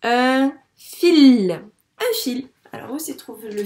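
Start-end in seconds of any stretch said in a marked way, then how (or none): 0.0-1.5 loud, stretched un fil; loud decoding. Shown as a demonstration. The teacher reads un fil loudly to correct the pupil. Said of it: "Mot outil".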